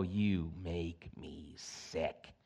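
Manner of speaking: very slow, low, quiet contempt; acted loathing, low and drawn out; mock disgust. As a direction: draw out each word in hushed, deep disgust. Acts God disgusted at the divorced.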